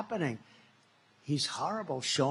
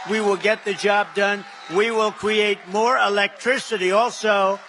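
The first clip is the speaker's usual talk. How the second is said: rally shout; 3 semitones higher, 7 dB louder than usual. Real, not acted. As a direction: whip the crowd up with each promise. Rally climax, crowd and music.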